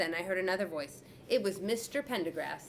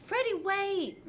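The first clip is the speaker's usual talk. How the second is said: put-on higher, slow call after the boy; teacher calling after a boy; 12 semitones higher, 3 dB louder, slower than usual. A narrator's reading. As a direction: call out to stop him. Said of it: "Missus Wishy called".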